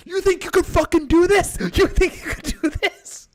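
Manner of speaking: high, loud mocking taunt; comic retelling his comeback to a heckler; triumphant taunt. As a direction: fling it back at the heckler, gloating. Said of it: "I'm like".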